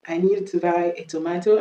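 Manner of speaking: British "tomato", to-MAH-to; British accent. Shown as a demonstration. Teacher repeating the example, British accent. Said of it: "on va faire l'accent british".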